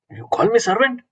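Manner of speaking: high-pitched mock indignation; incredulous, offended question leaping up in pitch; angry. Alexander reacts to being called a servant, angry disbelief. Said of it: "He was really angry".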